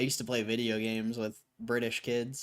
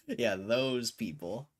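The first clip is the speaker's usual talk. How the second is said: ordinary delivery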